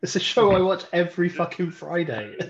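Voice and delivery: loud, high burst of delighted recognition; excited recognition. Real, not acted. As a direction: blurt it out as the show clicks. Suddenly recognises the named TV show.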